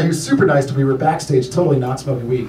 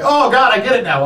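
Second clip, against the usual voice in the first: higher-pitched mock epiphany; sudden realization; 9 semitones higher, 3 dB louder than usual. Audience member catching on late, in mock sudden realization. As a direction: act out the joke finally landing.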